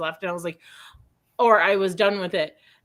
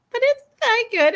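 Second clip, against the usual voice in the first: mock incredulous; 14 semitones higher, 4 dB louder than usual. Sister-in-law's skeptical question quoted.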